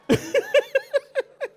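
long run of high-pitched giggles; high laughter. Real, not acted. Laughs at approved godly handshake.

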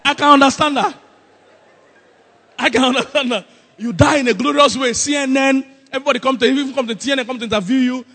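loud, high, excited pulpit voice; animated preaching. Real, not acted. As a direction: build the glorious scene at full volume. Preacher imagines glorious televised death.

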